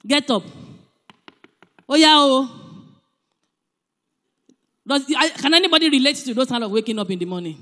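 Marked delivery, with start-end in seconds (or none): 0.1-2.6 louder, a mock startled yell; harsh wake-up. Shown as a demonstration. Parent roughly waking a daughter, shouting. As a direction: jolt the child awake. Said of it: "I cannot say".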